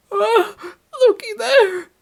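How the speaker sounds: very high, loud shriek; sharp old-woman scream. A narrator's reading. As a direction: shriek like an old woman who has seen a ghost. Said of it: "screamed sharply".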